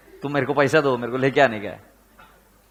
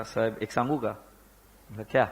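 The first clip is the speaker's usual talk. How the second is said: timid Marathi-speaking peon; 4 semitones lower, 7 dB quieter than usual. Retold or imitated. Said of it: "तुम्हें भाषा समझ में आई?".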